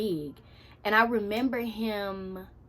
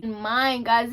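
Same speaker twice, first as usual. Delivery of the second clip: ordinary talk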